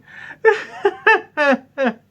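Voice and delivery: high, awkward forced laugh; nervous laugh. Retold or imitated. Son caught out by mum, laughing nervously. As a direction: laugh it off in panic.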